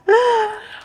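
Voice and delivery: short high embarrassed laugh; nervous laugh. Real, not acted. Vlogger to viewers, bursting into a high nervous laugh. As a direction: laugh it off before the confession. Laughs before revealing insecurity.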